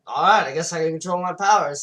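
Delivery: sudden hammed-up burst of confidence; mock resolve. Girl accepts she controls her powers, loud mock resolve. Acted girl's resolve in retelling.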